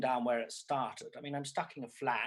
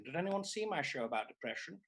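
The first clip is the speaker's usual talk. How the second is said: normal talking voice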